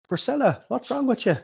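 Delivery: mildly alarmed, calling her round; concerned villager. Acted. Man tends woman who fainted.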